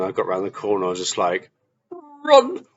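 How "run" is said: high-pitched, loud retold cry to flee; urgent cry. Telling himself to flee round the corner, high urgent yell. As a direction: yelp it out high, acting the escape.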